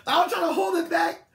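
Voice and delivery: high, loud, laughing; real laughter while telling the story; laughing hard. Real, not acted. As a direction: barely get it out through laughter.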